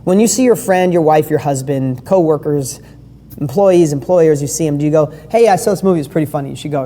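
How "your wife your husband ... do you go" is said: normal talking voice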